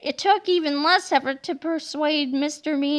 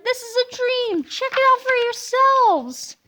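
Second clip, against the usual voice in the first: high giddy voice; 9 semitones higher, 4 dB louder than usual. Acted. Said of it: "giggled Miss Labeler".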